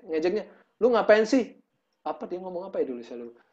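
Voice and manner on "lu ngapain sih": mocking jeer. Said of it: "mereka ngejek".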